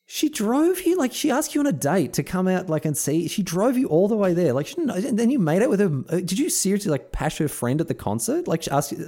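high, indignant friend telling him off; scolding friend. Retold or imitated. Friend scolding him for ditching his date, high and indignant. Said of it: "one of them was like".

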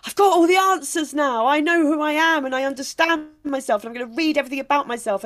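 high, bright rush of new hope; mock euphoric. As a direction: gush like you've found all the answers. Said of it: "initial euphoria of going".

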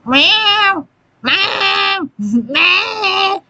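high, drawn-out cat yowl; howling cat meow. Retold or imitated. Cat waking its sleeping owner, high yowling meows. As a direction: imitate a cat meowing insistently, high-pitched. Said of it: "She waits till you're in that good sleep".

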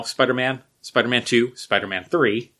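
ordinary talk

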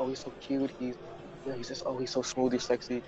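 lightly mock how people fawn over him; mock swooning admirer. Mimicking people who think he's cute, hushed gushing.

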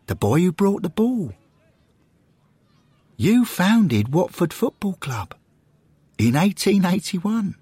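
high-pitched, excited character voice; grand, high, reverent address; heartfelt tribute speech. Acted.

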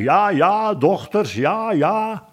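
high, odd-voiced minister; startled yes-man. A narrator's reading. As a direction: agree hastily in a high put-on voice. Startled third minister agrees blindly.

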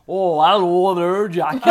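loud, slow, exaggerated northern grandma greeting; mock northern accent. Kids mocking grandma on her visits, broad northern accent. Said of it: "really northern accent".

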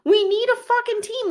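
mock outrage. Wife upset that he sold the team, mock outrage.

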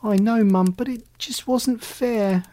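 high, moaning kitten protest. A narrator's reading. Kitten complaining to mum, moaning. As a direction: moan that it's unfair. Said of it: "Alley Cat moaned".

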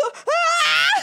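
sudden high frightened yelp; jolted by something awful on screen; startled cry. Real, not acted. Shocked at a video, a sudden high yelp.

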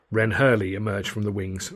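ordinary delivery, nothing marked

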